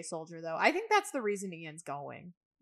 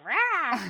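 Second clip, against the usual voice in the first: short raw "rah" yell, louder and higher; mock scream; 8 semitones higher, 8 dB louder than usual. Co-host mimicking how a character always screams, mock scream. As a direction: imitate her funny little scream. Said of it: "screams, too, is so funny".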